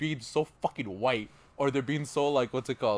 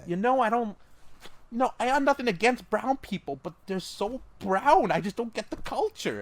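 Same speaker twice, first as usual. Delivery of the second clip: mock teacher; 7 semitones higher than usual. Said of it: "teachers ... so they're like".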